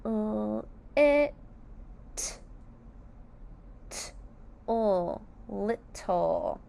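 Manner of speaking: L, it, t, o spaced, then little; sounding out little. Shown as a demonstration. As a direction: sound each part then blend little. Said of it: "Let's sound out little".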